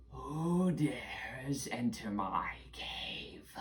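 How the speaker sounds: drawn-out raspy creature voice. Acted. Game master as cave creature challenging intruders, slow and raspy. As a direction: rasp it very slowly, like a menacing cave dweller. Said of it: "raspy voice go".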